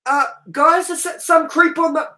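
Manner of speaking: hammed-up scared cinema worker stammering; mock panicked staff. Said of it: "They're just like".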